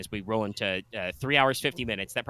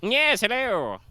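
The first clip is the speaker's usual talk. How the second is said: put-on high, louder posh British accent; Sherlock Holmes voice; 11 semitones higher, 8 dB louder, much slower than usual. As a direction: snap into a British detective. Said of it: "done the Sherlock Holmes voice".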